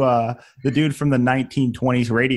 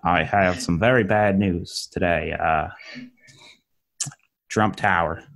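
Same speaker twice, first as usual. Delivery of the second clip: lightly Southern newsreader, low and grave; Southern accent anchor; 5 semitones lower than usual. Pretend. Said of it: "I don't know why I went Southern".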